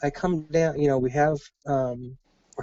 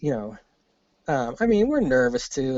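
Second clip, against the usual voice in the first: ordinary talk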